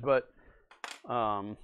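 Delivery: usual speaking voice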